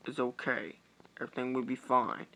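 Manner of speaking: ordinary delivery, nothing marked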